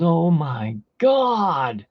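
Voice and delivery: slow, drawn-out amazement; stunned. Retold or imitated. Friend staring at the slot machine, slow awed gasp. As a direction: let it sink in slowly, awed. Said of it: "And he goes".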